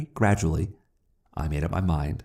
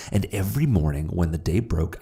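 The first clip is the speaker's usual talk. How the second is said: ordinary delivery, nothing marked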